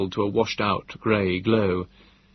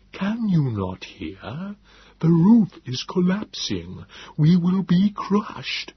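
slow, high alien Morlock voice; Nebogipfel, alarmed; 7 semitones higher, much slower than usual. A narrator's reading. The Morlock warning the roof will crush them, slow, high and alien. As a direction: warn of the roof, drawn out and strange.

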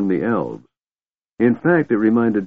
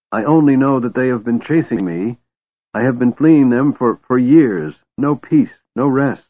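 higher, louder anguished pleading; desperate; 5 semitones higher, 5 dB louder than usual. A narrator's reading. Beram desperate, hunted for years.

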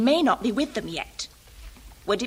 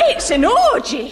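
loud and slow, scandalised; shocked exclamation; 6 semitones higher, 5 dB louder than usual. Acted. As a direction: gasp it out in horror. Shocked woman bursts in.

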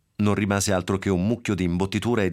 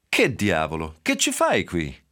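startled; slower than usual. Acted. Danny finds the dog inside.

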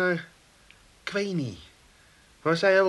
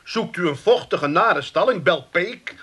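punchy, loud sales jingle delivery; mock advertisement; 4 semitones higher, 4 dB louder, slower than usual. Peek parodying an advert for his stalling, mock salesman. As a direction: pitch it like a sarcastic radio ad. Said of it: "jongen, wat reclame".

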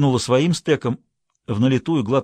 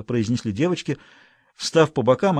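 ordinary delivery

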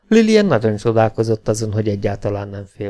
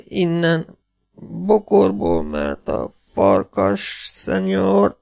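high, slow broken speech; wounded half-breed; 9 semitones higher, much slower than usual. Acted. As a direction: drag each word out in pain. (magas, lassú, tört beszédű sebesült)

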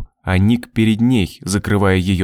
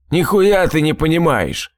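higher, heated retort; angry outburst; 6 semitones higher than usual. A narrator's reading. Drunk husband banging table at wife, angry. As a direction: hurl it at her, pitched up. Quarrel, man bangs the table.

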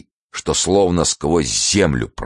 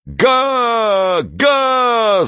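high, drawn-out roar; long high-pitched crowd holler. A narrator's reading. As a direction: bellow like a noisy crowd. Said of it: "зашумела толпа".

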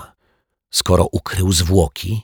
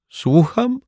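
high, sharp questioning little voice; a child caught off guard; surprised girl; 14 semitones higher than usual. A narrator's reading. Asia startled when the detective interrupts, high surprised question.

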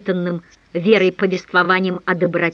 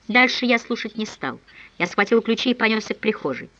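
ordinary delivery, nothing marked

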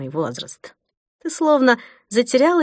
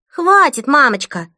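put-on high, louder bossy child; stern little girl; 6 semitones higher, 5 dB louder than usual. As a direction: scold mom like a strict child. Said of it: "строго произнесла Хлоя".

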